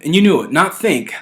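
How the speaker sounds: knew hit high and loud; stress on knew. Real, not acted. To viewers, stressing knew over think. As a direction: punch the word knew against think. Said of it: "there's a difference between those two words".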